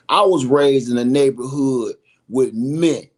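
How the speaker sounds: emphatic declaration. Real, not acted. Telling viewers about his upbringing, loud and emphatic. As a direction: hammer it home to the viewers as a firm declaration. Repeats the point, first time emphatic.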